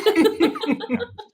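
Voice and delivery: sudden high laugh; high burst of laughter. Real, not acted.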